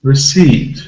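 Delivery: "Receipt" stressed on CEIPT; quick weak re, strong long ee in Receipt; second-syllable stress. Shown as a demonstration. Teacher showing an exception, stress on second syllable. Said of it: "the stress is on the second syllable".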